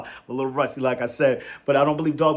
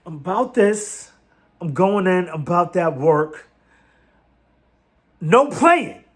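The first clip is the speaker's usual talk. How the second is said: higher, louder, emphatic draft-day declaration; intense player's vow; 4 semitones higher, 5 dB louder, slower than usual. Retold or imitated. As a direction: act out a fired-up player addressing the crowd. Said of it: "I can't express it the way he did".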